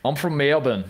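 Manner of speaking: put-on Australian accent. Shown as a demonstration. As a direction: claim Melbourne in an Australian accent. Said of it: "I can do Australian".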